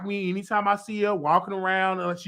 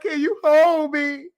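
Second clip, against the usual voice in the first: whiny plea; 9 semitones higher, 3 dB louder than usual. Retold or imitated. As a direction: beg to be held, half-sobbing. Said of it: "I was like".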